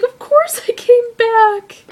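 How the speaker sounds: high, slow, emotional imitation of a film heroine; upset reassurance. Belle answering the dying Beast, high upset insistence. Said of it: "like, upset".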